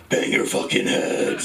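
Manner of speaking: drawn-out raspy growled yell; imitated metal-style voice. Comic quoting the voice someone used, raspy growl. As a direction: imitate a rough growling frontman. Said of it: "the voice. You were like".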